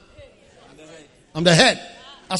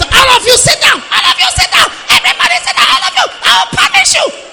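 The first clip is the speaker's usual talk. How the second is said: shrill, much higher and louder, barking orders; stern headmistress; 13 dB louder than usual. Shown as a demonstration. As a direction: scold a class of unruly pupils. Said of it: "voice of a headmistress".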